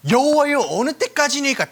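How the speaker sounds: high, loud plea to the Lord; David's lament. Acted. David crying out to the Lord, high and loud. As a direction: cry it out like David. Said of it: "다윗이 지금 하나님한테 탄식을".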